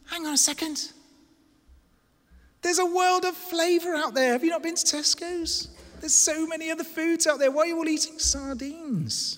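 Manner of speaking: high-pitched baffled protest; acted incredulity. Visitor challenges a city of sardine eaters, high-pitched incredulity. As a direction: play a visitor who can't believe it. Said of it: "You would come into that city and you say".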